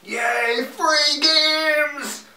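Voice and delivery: high, loud giddy squeal; squeaky excited cheer. Acted. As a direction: cheer it like an overexcited kid. Mock cheer at news item.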